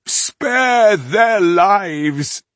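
high, loud, drawn-out wail; desperate supplication. A narrator's reading. Rebel on his knees begging the elf, desperate supplication. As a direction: beg with everything, stretching each word. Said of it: "stretched up his arms in supplication".

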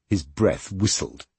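ordinary delivery

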